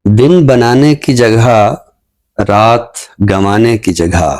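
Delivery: slow, measured poetry recital with lingering line ends; ghazal recitation. Acted. Poet reciting his ghazal to the show's audience, slow and measured. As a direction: recite each line slowly, savouring it.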